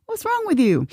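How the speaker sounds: high-pitched mock scolding of oneself; a baffled telling-off, pitched right up; self-scolding. Teller scolds herself for crying all the time, mock scolding.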